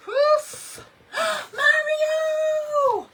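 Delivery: squealing high yelled greeting; thrilled cry of a friend's name; excited shout. Real, not acted. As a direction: call out with a big delighted gasp. Excited greeting viewer Mario.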